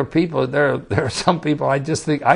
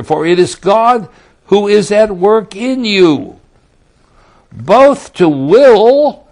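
loud, slow, soaring pulpit delivery, higher and louder; full-force preaching; 6 semitones higher, 9 dB louder than usual. Acted. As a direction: proclaim it to the whole hall.

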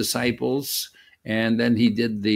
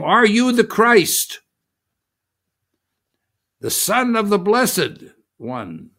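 sharp high loud demand from the high priest; interrogating priest, pitched high and loud; challenging high priest; 8 semitones higher, 5 dB louder than usual. Acted. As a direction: fling the question up at the prisoner. High priest questions Jesus.

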